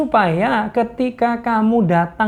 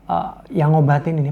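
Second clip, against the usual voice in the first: normal talking voice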